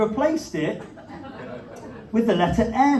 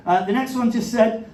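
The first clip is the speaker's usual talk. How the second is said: usual speaking voice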